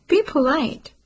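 put-on a flustered mother nudging her child; embarrassed scolding. A narrator's reading.

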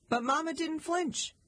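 plain reading voice

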